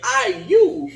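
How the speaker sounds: drawn-out high, loud mock howl; mimicked howl. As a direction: throw it up like a comic howl. Co-host mimics the howl mockingly.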